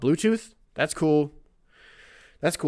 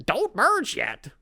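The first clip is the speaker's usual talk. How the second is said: a light, higher squawky impression; Gilbert Gottfried voice; 7 semitones higher, slower than usual. A hint of Gilbert Gottfried warning the driver. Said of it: "Gilbert Godfrey, Aflac".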